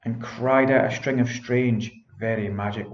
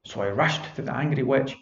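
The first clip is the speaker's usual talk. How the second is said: ordinary delivery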